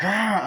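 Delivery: high, loud mock roar; imitated yell. Guy charging before he is shot, imitated yell.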